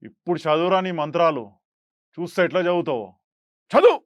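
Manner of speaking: loud, threatening movie line; menacing dialogue. Retold or imitated. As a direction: taunt the priest with force. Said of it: "first డైలాగే".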